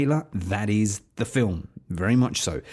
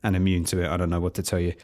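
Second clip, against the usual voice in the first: ordinary delivery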